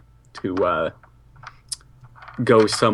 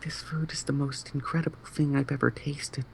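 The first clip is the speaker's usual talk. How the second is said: whispering; 4 semitones higher, 10 dB quieter, faster than usual. Acted. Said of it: "just go".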